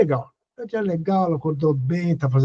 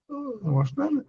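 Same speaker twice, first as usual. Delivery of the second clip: soft, higher-pitched disclaimer; unbothered quiet reply; 7 semitones higher, 8 dB quieter than usual. Retold or imitated. Answering someone fishing for a reaction, soft and unbothered. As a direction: answer lightly and softly, declining to react.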